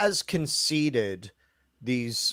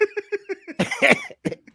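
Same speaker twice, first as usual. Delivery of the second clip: a sudden high, squealing burst of laughter. Real, not acted. Cohost squeals with laughter at the Michael Myers joke.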